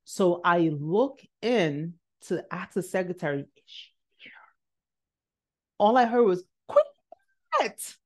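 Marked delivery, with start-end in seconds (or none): 3.4-4.3 barely audible unvoiced whisper. Student asking the secretary about the professor, whispered. 6.7-8.0 very high, sing-out call of a name. Retold or imitated. Professor calling to the student from inside, high-pitched call.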